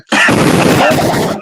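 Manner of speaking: big burst of high, loud laughter. Real, not acted. Vaper laughing after a joke about bad juices, loud laughter.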